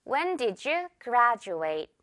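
"graduate" said GRAD-joo-ate, slowly; j sound in graduate. Shown as a demonstration. Teacher modelling the question for students, j sound. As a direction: say "When did you graduate" with a j for the d. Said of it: "한 번 더요".

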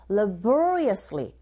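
laboriously slow, the O syllable punched; slow drill with stress on the middle of laboriously. Shown as a demonstration. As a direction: lean on the O in laboriously. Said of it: "이 부분에 강세 있죠?".

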